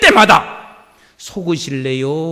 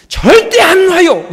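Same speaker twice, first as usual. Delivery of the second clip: slow, loud, higher cry; never let go, shouted slowly; emphatic shout; 9 semitones higher, 9 dB louder, slower than usual. Real, not acted. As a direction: declare it with full force. Preacher's emphatic declaration.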